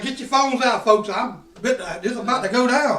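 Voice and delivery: rally the onlookers at full voice; calling out to crowd. Retold or imitated.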